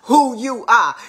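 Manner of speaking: mock dismissive shrug-off; dismissive retort. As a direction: drawl out that you don't care. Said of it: "Somebody like".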